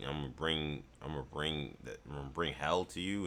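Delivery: imitating Thor. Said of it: "that line with Thor was like".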